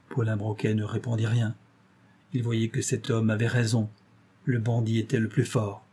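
ordinary delivery, nothing marked

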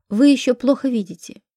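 plain reading voice

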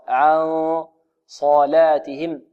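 slow "عن صلاتهم", long dark hum on ن before heavy ص; noon coloured by ص. Shown as a demonstration. Teacher shows the noon's influence, slow heavy hum in "عن صلاتهم". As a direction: hold the hidden ن, then thicken "صلاتهم". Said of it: "وبعدها النون تتأثر بماذا".

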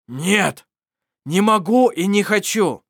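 loud, high defiant refusal; angry refusal. Acted. Mar loudly refusing his friends. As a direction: bark a refusal.

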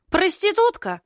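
very high shriek after her; shrill shout. Acted. The startled neighbour yells after the girl: shrill shout. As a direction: screech the insult at her back. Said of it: "летит в спину".

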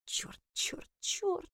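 whispered panicky cursing; шёпотом про себя. A narrator's reading. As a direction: curse under your breath, almost voiceless. Героиня ругается про себя. (тихая ругань шёпотом)

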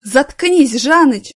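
loud high shout. Acted. Manager yelling at drunk coworker, loud. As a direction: yell at him to shut up. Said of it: "закричала".

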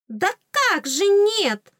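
very high, loud, drawn-out protest; indignant fairy. A narrator's reading. Fairy objects to heroine, high indignation. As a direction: squeak it in outraged disbelief. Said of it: "возмутилась оскалка".

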